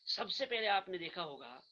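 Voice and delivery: ordinary talk, nothing marked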